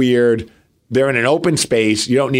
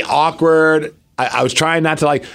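usual speaking voice

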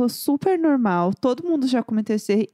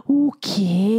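shocked gasp; 3 semitones higher than usual. Real, not acted. Wife shocked that the dog mess went uncleaned, a gasp and a pained what. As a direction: react with disgust and disbelief.

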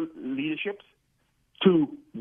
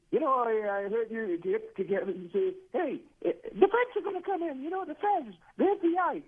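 mock impression of the host, much higher; mimicking Glenn; 6 semitones higher than usual. Caller mocking the radio host's voice, high. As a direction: caller imitating the host's voice, pitched way up. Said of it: "if you're gonna mimic me".

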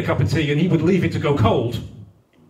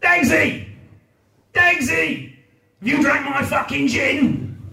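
working-class mum shouting for Tom; bawling working-class mum; 9 semitones higher, slower than usual. Retold or imitated. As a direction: yell up the stairs at Tom.

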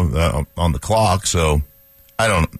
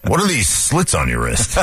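ordinary talk, nothing marked

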